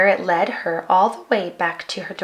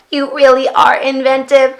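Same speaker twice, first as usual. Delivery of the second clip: happy beeping robot voice; a cheerful little robot; joyful robot; 3 semitones higher, 4 dB louder than usual. A narrator's reading. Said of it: "bleeped the robot joyfully".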